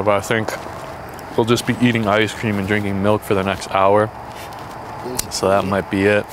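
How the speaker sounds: ordinary delivery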